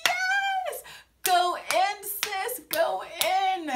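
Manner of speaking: very high, squealed yes; gleeful high-pitched hype; cheering her on. Retold or imitated. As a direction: egg her on like a fan. Cheering the sister on.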